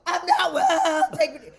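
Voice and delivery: drawn-out, loud, high wail with laughter; mock distressed wail. On acid and hoping it gets better, mock distressed wail. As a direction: wail it like a hammed-up bad trip.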